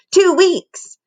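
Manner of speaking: put-on high, chirping little-girl voice; chirpy toddler. Toddler delights in no school, high chirp. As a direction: chirp it like a happy three-year-old. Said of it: "chirped Vericella".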